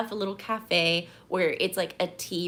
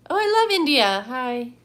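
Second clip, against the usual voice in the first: excited greeting; 4 semitones higher, 7 dB louder than usual. Real, not acted. Reacting to a viewer from India.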